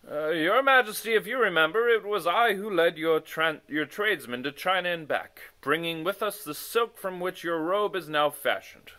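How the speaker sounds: loud, earnest pleading; boastful messenger. Acted. Moggins tells the king he earned the crown, earnest. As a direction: argue your own case.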